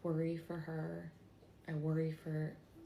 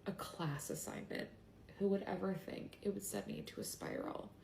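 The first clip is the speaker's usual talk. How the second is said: grieving, struggling to get the words out; real sadness; 3 semitones higher, 4 dB quieter than usual. Real, not acted. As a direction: voice thick with real grief. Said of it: "I just am so sad".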